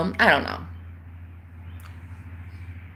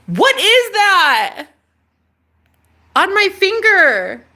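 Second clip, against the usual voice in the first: high, loud and slow; startled by something on your finger; alarmed question; 9 semitones higher, 5 dB louder, much slower than usual. Real, not acted. Asking viewers about the thing on her finger, startled.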